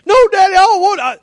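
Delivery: put-on high, fast little-kid protest; mock scared boy. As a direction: plead with Dad like a frightened child. Imitates son refusing again.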